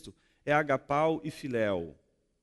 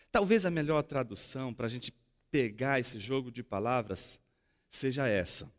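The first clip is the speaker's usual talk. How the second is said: ordinary talk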